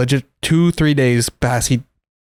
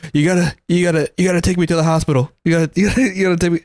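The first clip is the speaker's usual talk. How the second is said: higher, stammered repeats of a plea; imitated stuttering; 4 semitones higher, much faster than usual. Retelling the friend begging for the hospital, imitated stuttering. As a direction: stammer it out urgently, imitating him. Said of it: "He was stuttering".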